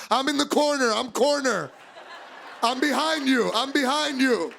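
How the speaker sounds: high, urgent kitchen call; warning call-out. Acted. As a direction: call it out like a line cook.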